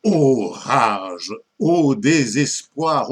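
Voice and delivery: grand classical verse delivery; declaimed lamentation. Acted. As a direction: declaim it slowly as a mock-tragic poet's lament. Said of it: "les lamentations de Chapelain".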